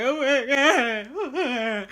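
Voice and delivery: hammed-up high, loud wail; mock-sob it, drawn out slowly at first; crying. Woman taking braids out to find bugs, crying. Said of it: "I think I did cry".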